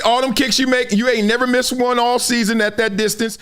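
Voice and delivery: ordinary talk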